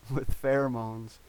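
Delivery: usual speaking voice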